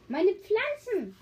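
high, slow cry of alarm; high slow cry over her plants. A narrator's reading. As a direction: wail it like a worried mother. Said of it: "rief Frau Tepes".

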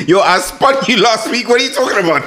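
laughing. Real, not acted. Laughing protest.

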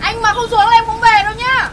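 put-on high, loud pleading girl; lovesick girl. Girl at the door pleads with Nam, high and loud. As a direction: plead up at him, still loud.

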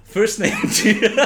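breaking into laughter mid-line; giggling through the name; laughing. Acted. Jim clarifies, laughing.

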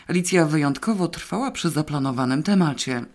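plain reading voice

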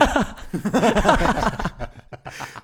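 loud, high cracking-up laugh; burst of laughter. Real, not acted. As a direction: crack up hard at your own story. Cracks up at own story.

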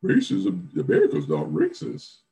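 high, loud sarcastic politician impression; mocking politician voice. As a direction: parody a smug senator's spin. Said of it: "to say, like".

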